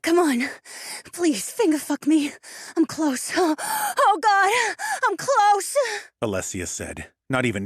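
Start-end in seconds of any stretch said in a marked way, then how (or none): 0.0-3.3 high, breathless, begging woman; pleading woman's voice. A narrator's reading. Alessia pleading near climax. 3.4-4.0 sudden shrill high gasp. A narrator's reading. Alessia gasps near climax. 4.0-5.8 high, loud ecstatic cry; ecstatic woman's voice. A narrator's reading. Alessia crying out near climax.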